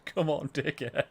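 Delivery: half-hearted heckle through laughter; laughing mock yell. Player mocking himself on the halfway line, laughing. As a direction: toss out the heckle while cracking up.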